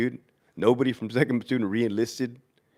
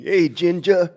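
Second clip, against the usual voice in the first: lightly imitated British soldier's call; higher-pitched teasing call, a hint of British; mock British accent; 4 semitones higher, slower than usual. British soldiers hailing red-haired King, mock British accent. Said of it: "the British fucking soldiers".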